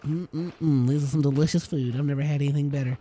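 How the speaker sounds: lightly voiced happy little workers savoring food; mock satisfied colonists. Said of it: "They're just like".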